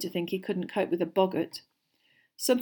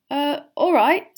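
hesitant, slow, higher-pitched acceptance; awkward, halting agreement; 8 semitones higher, 7 dB louder, slower than usual. A narrator's reading. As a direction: accept uncertainly after a drawn-out uh. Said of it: "said Harry awkwardly".

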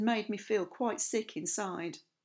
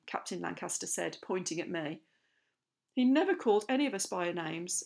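ordinary delivery, nothing marked

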